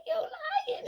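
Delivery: squealed very high, laughing through it; giddy disbelief. Retold or imitated. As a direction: shriek it like you can't believe your luck. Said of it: "was like".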